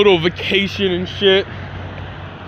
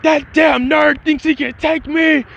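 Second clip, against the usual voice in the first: high, strained, slowed-down outrage; hammed-up hot-headed fury at a rival; indignant rant; 8 semitones higher, slower than usual. Hot-headed boy outraged by a rival's boast, indignant.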